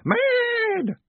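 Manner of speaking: "Mad!" very high, loud and long drawn out; savouring MAD. Acted. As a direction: hold on to "Mad!", loath to let it go. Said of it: "loath to let it go".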